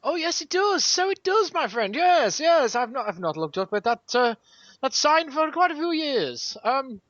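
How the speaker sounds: put-on high, swooping posh old chap; mock old-fashioned gentleman. Host bumbles on about the podcast title, mock old gentleman. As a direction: ham it up as a fusty, agreeable gent. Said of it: "I don't know why I'm talking like this anymore".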